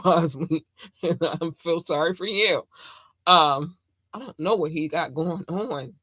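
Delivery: ordinary talk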